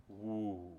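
a low, soft awed ooh; hushed ooh. Real, not acted. Preacher to congregation, a low soft ooh of awe. Awed reaction to Satan's presence.

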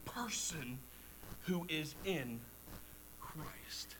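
breathy hushed whisper; whispered sermon climax. Real, not acted. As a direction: drop to an intimate whisper. Preacher whispers closing point.

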